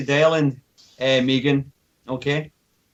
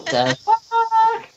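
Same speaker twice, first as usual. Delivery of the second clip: very high, very slow squeal; furry voice. Pretend. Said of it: "You have to do the furry voice".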